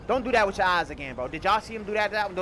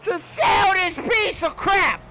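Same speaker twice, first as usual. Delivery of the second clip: slow, higher, louder hammed-up shout; angry shout; 10 semitones higher, 6 dB louder, much slower than usual. Gamer answers the mechanic about his car, slow hammed-up shout. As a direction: yell it at the mechanic, dragging each word. Mechanic asks why he came.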